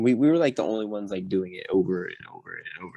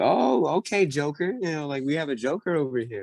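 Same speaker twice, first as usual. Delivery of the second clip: mock-impressed, pitched-up needling; imitated mocking taunt; 6 semitones higher than usual. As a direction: mimic an instructor singling out the clown. Instructors mock him for laughing.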